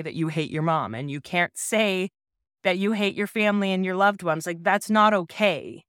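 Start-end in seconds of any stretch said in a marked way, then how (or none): none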